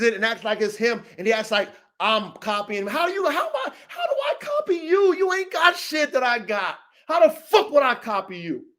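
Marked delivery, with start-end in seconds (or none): 2.9-6.8 high-pitched squeal of disbelief; incredulous. Real, not acted. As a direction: voice shoots way up, stumbling over the question. Incredulous at being accused of copying.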